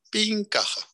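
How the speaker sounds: high chirpy ding sound, played for a laugh; mock cash-register ding. Donor drops the money into the till, imitated ding. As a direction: chime it like a till taking money.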